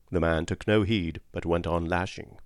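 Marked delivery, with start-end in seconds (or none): none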